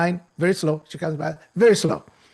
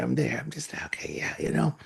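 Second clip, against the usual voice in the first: hushed aside to himself; whisper; 4 semitones lower, 8 dB quieter, faster than usual. Retold or imitated. Waiting at the luggage desk, muttering in a whisper. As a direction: whisper it under your breath.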